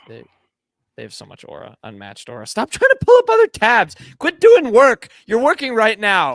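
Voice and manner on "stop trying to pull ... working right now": mock scold, loud and high; playful scolding shout. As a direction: yell at him to stop working, teasing. Teasing co-host for working.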